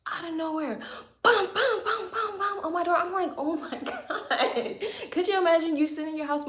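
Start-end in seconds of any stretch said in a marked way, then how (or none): 1.2-3.0 high, punchy bum bum knocks; sharp sung-out knocking noises; imitated door pounding. 3.4-4.8 slow, laughing through it; a drawn-out laugh breaking the words; laughing oh my God. Retold or imitated. Startled by the knocking.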